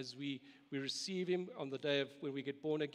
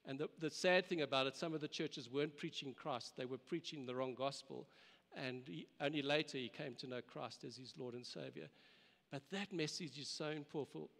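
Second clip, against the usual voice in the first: usual speaking voice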